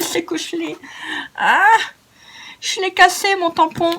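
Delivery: high-pitched dismayed whine, half laughing. Real, not acted. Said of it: "je suis un peu dégoûtée". (plainte dégoûtée, très aiguë)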